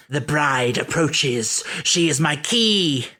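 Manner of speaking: slow, hammy pantomime villain; panto villain. Retold or imitated. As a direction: sneer it like a Christmas panto baddie. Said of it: "she sounds like a panto villain".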